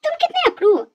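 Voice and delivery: बहुत ऊँचे सुर में झुँझलाहट; तुनककर उलाहना दो; चिढ़ा हुआ ताना. Acted. चुप लड़के से झगड़ती लड़की. (खीझ भरा ताना)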